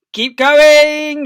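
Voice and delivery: loud high shout; shouting Boggis. Acted. Boggis shouting to Bunce and Bean over engines. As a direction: bellow it to the others. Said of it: "Boggis shouted".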